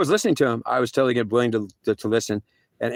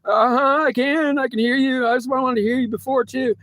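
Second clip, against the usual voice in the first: much higher, small desperate voice; pleading squeak; 12 semitones higher than usual. Retold or imitated. God mimicking him begging not to die, very high and small. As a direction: shrink into a tiny begging voice.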